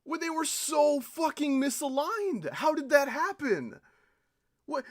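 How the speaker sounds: loud, high gamer rage; furious player yelling at a bad level; frustrated rant. Real, not acted. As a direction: vent at the game, loud and high. Raging at misaligned ships.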